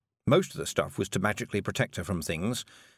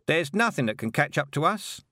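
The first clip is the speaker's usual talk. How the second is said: obliging driver; 6 semitones higher than usual. A narrator's reading. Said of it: "because he hoped for a good tip".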